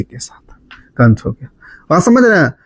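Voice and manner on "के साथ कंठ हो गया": breathy whispered aside. Real, not acted. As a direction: whisper it to the class. Aside to the class. (धीमी फुसफुसाहट)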